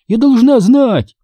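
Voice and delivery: high, drawn-out insistence; demanding. A narrator's reading. Sister pressing her brother for an answer, demanding. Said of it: "потребовала я".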